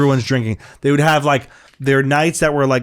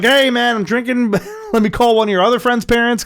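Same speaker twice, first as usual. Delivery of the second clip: high-pitched mock confession; 11 semitones higher than usual. Voicing what dad should have said.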